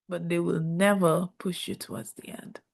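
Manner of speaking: ordinary delivery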